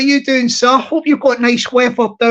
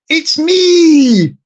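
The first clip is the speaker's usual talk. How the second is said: higher, drawn-out call; mock shout; 5 semitones higher, 4 dB louder than usual. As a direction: call out to the neighbours from the garden. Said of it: "and shout out".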